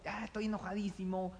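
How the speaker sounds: a hammed-up mock grumble of being very angry; mock anger. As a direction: play at being furious, not for real. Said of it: "Estoy súper enojado".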